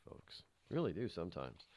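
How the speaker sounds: ordinary talk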